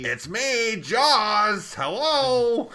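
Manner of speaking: high, loud silly monster voice; goofy Jaws voice. Pretend. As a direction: greet like a cheerful killer shark. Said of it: "imagine Jaws".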